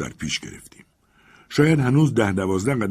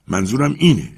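emphatic pointing; 5 semitones higher, 6 dB louder than usual. A narrator's reading. Legrand points to eye.

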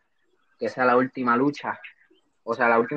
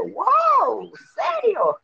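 surprised; much slower than usual. Retold or imitated. Retelling own surprised reaction.

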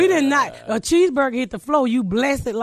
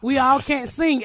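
normal talking voice

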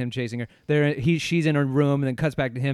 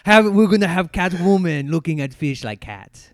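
loud laughing French director pitch; a giddy Frenchman pitching ideas, laughing; mock French accent; 4 semitones higher, 6 dB louder than usual. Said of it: "only way I can do the accent".

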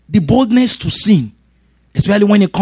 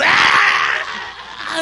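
kid's mock scream. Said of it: "Not kids just".

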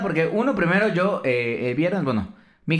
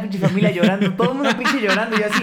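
laughing; 6 semitones higher than usual. Real, not acted. Laughing while telling of family crying.

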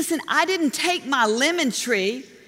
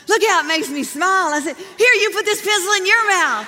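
playful urging; 7 semitones higher, 5 dB louder than usual. Retold or imitated. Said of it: "and I said".

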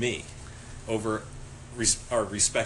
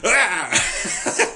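loud, high raptor cry; velociraptor screech; 7 semitones higher, 7 dB louder than usual. Retold or imitated. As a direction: screech like a dinosaur mid-rampage.

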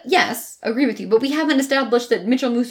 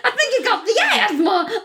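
put-on laughing through a high Southern accent parody; a giggly send-up of a Southern accent; mock Southern accent; 8 semitones higher than usual. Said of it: "cat on a hot tin roof Southern accents".

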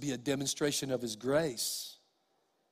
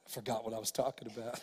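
laughing aside; 6 semitones lower, 11 dB quieter, faster than usual. Real, not acted. Laughing aside after funny story.

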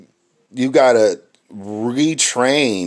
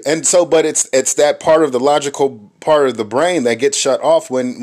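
ordinary talk, nothing marked

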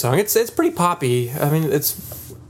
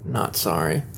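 teasing aside; 9 semitones lower, 5 dB quieter, slower than usual. Acted. Teasing after apology.

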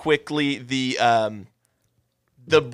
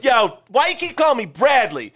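mock character; 8 semitones higher than usual. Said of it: "He goes".

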